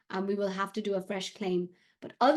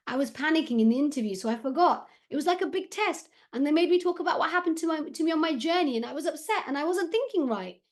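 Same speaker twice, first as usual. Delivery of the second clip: higher, shaking voice, a touch upset; lightly acted distress, the voice climbing as he remembers; a boy upset reliving the Home Office interview, voice shaking; 8 semitones higher than usual. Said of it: "His voice shook".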